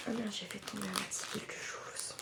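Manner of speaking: whispered under the breath; whispered curse. Real, not acted. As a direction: hush it out as a curse. Drops something, swears under breath.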